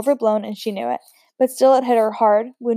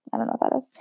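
unvoiced whisper, half to herself; a reader's private whispered aside; 7 dB quieter, much faster than usual. Real, not acted. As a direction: drop to a breathy puzzled whisper. Reader's aside on quince paste.

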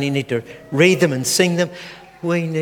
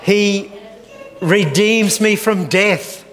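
high, slow, ringing call; proclaiming; 5 semitones higher, slower than usual. Acted. Preacher leading psalm call and response. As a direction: stretch it out as a cue. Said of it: "give you the signal".

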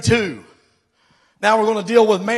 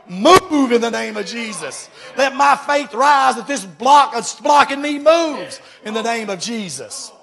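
louder and higher, a bold command; commanding the stone; 4 semitones higher, 5 dB louder than usual. Pretend. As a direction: order the stone to move in faith. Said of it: "speak to that stone and say".

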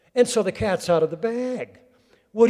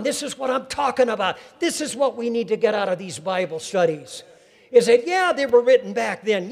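higher, louder revival preaching, pressing the crowd; preaching at full force; 6 semitones higher, 6 dB louder than usual. Acted. Preacher urging the congregation to share their joy, full-force preaching. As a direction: drive it home like a revival preacher.

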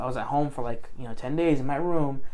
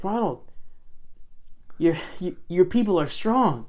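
manager's pep talk; 6 semitones higher than usual. Retold or imitated. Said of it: "He goes".

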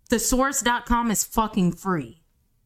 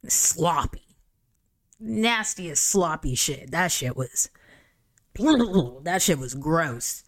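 disgusted; 2 semitones lower, slower than usual. Real, not acted. Disgusted by sloppy sex scene.

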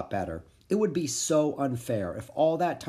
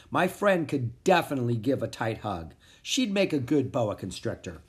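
usual speaking voice

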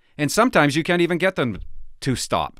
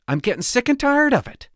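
pitched up, fed up and venting; rising exasperation, louder the second time; exasperated outburst; 9 semitones higher, 3 dB louder than usual. Real, not acted. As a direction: say it again, higher and sick of it all. Repeats complaint, rising anger.

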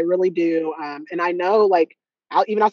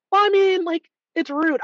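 put-on much higher, prim old lady voice; grandma's scolding; 11 semitones higher than usual. Mocking her grandma who dislikes skipped small talk, high and prim. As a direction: play a mildly scolding grandma. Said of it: "my grandma will be like".

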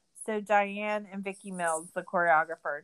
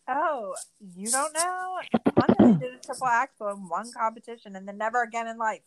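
mock condescending; 3 semitones higher, 5 dB louder than usual. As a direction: throw her own snootiness back at her. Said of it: "Now it's my turn to be like".

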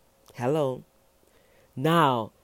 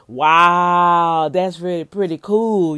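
loud, delighted wow; excited wow; 3 semitones higher, 8 dB louder, slower than usual. Shown as a demonstration. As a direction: sound genuinely impressed. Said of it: "or I'll say".

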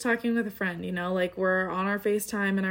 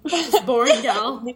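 laughing tease; 3 semitones higher, 7 dB louder, slower than usual. Real, not acted. Teasing friend about no facts.

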